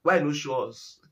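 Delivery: ordinary delivery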